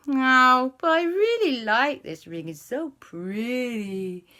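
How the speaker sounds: high, drawn-out pout, softening at the end; disappointed pleading. A narrator's reading. Aunt begs to keep the pretty ring, disappointed pleading.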